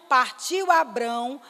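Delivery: ordinary delivery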